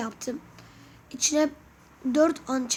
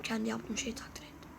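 whispered aside; 3 semitones lower, 12 dB quieter than usual. Real, not acted. Self-praise aside.

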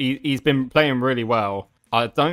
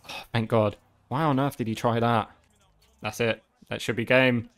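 ordinary talk

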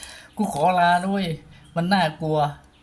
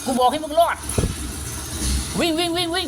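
panicked urging; 6 semitones higher than usual. Real, not acted. Fleeing the monster in panic.

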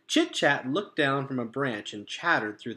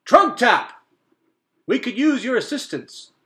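loud, high call up into the trees; hollering; 11 semitones higher, 12 dB louder than usual. A narrator's reading. Mister Beaver hollering up to Trunk Tap in the canopy. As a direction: holler to a bird far above. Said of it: "threw back his head and hollered".

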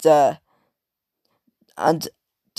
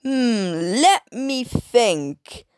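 high, slow pondering voice; slow high mock musing; imitating Miss Hattie; 7 semitones higher, much slower than usual. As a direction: drag it out as a thinking old lady. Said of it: "Miss Hattie was saying this".

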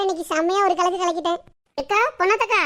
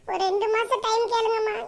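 normal talking voice

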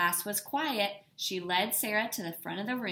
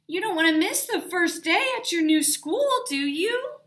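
a dad's coaxing call, loud and high; cheerfully nudging a sleepy child; 9 semitones higher, 8 dB louder than usual. A narrator's reading. Dad coaxes Sarah out of bed.

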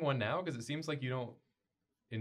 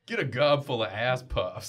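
ad announcer voice; 3 semitones higher, 9 dB louder than usual. Acted. Mock cereal commercial.

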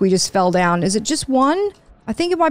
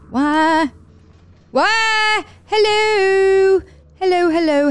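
high, stretched-out wailing calls; plaintive calling out; 9 semitones higher, much slower than usual. Real, not acted. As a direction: call out high and anxious into the unknown.

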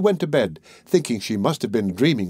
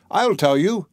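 high-pitched eager call; crying out; 7 semitones higher than usual. A narrator's reading. Tom interrupts the thieves planning a robbery, crying out. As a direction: pipe up in a high voice. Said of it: "cried Tom".

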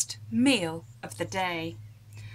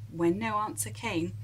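plain reading voice

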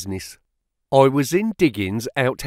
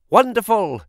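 higher-pitched acted exclamation; delighted cry; 8 semitones higher, 4 dB louder than usual. A narrator's reading. Financial agent praising the young clerk's answers, delighted cry. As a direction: cry out in admiration. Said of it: "he cried".

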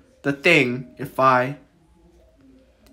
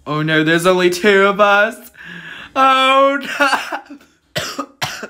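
high, loud, rising panic; loud alarm; 5 semitones higher, 5 dB louder, much slower than usual. Real, not acted. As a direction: cry out louder and higher in alarm. Alarm, only two players left.

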